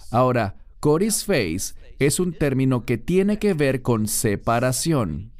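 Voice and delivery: usual speaking voice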